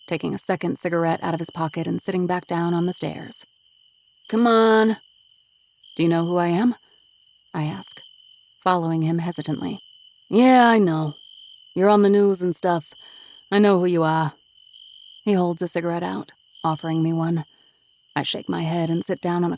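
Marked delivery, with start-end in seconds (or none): 4.3-14.3 loud, tough street guy voice; gruff East Coast guy Vinny, defensive. Acted. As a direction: tough East Coast smoker brushing her off.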